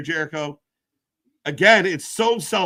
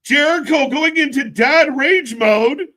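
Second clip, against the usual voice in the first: high, loud, slow hyped call; mock hype; 9 semitones higher, 7 dB louder than usual. Real, not acted. Announcing the wrestler's tantrum, loud mock hype. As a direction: call it like a big moment.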